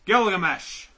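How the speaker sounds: Picard impression. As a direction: announce Gilgamesh like a starship captain. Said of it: "That was my v-, rendition of Picard".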